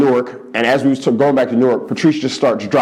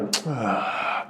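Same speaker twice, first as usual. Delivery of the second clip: weary sigh; 12 dB quieter than usual. Retold or imitated. Weary sigh after question.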